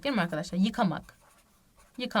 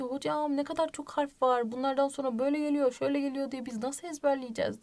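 whiny student complaint; 5 semitones higher than usual. Retold or imitated. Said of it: "Şimdi diyeceksiniz ki".

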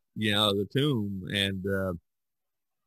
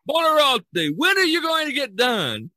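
high, loud nagging demand; impatient pope; 13 semitones higher, 5 dB louder than usual. Retold or imitated. Pope nagging the painter to finish, impatient high call. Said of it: "keeps coming and says".

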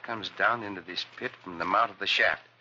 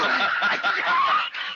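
high, loud burst of laughter; big laugh; 5 dB louder than usual. Acted. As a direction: let the laugh explode. Cisco laughing again.

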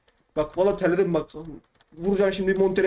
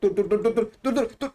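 climbing higher with each stop; panicked stops; 4 semitones higher than usual. Real, not acted. Gamer begging the game to wait, climbing panic. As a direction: plead with the game to hold on. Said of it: "çok değişik sesler çıkartıyorum".

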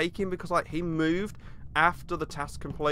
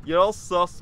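normal talking voice

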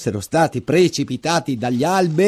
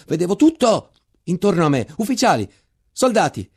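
higher, urgent storytelling; intense rising narration; 7 semitones higher than usual. A narrator's reading. Narrator reliving the charge, urgent and heightened. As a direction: carry them into the charge.

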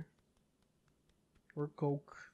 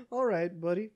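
normal talking voice

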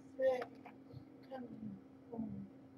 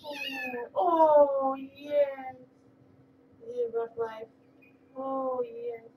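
much higher and louder cooing oh yes; squealing baby-talk to a pet; gushing pet talk; 4 semitones higher, 9 dB louder, much slower than usual. Acted. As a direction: swoon over the cat. Gushing over the cat.

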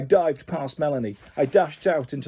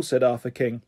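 ordinary delivery, nothing marked

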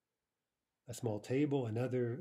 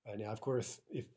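ordinary talk

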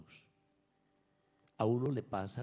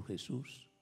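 ordinary talk

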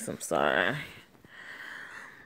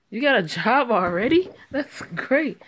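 louder, higher, thrilled burst; excited; 6 semitones higher, 8 dB louder than usual. Acted. Mother thrilled at daughter's new job, excited. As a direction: cheer her on about the new job.

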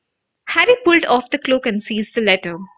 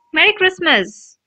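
cheerful greeting; 7 semitones higher than usual. A narrator's reading. Twins burst in greeting.

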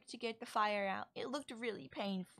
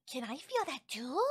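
ordinary talk, nothing marked

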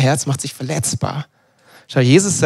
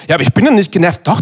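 normal talking voice

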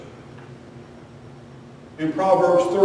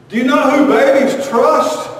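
higher, louder insistent question; louder repeat; 7 semitones higher, 7 dB louder than usual. Acted. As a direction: push the question again, demanding an answer.

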